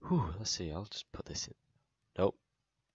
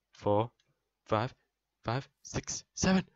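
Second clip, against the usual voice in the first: building triumph; 11 semitones higher, 8 dB louder, slower than usual. Real, not acted. Player counting up to success, building to a triumphant cheer. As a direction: count climbing to a loud, high cheer.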